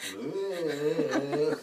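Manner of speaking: loud laughing fish blub; loud, silly fish blub. Shown as a demonstration. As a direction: pop out a big silly blub.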